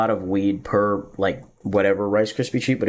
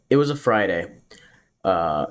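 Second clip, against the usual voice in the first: usual speaking voice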